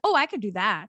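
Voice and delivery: usual speaking voice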